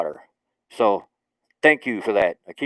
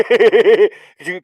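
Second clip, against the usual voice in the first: usual speaking voice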